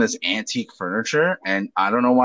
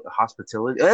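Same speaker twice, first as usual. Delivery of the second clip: usual speaking voice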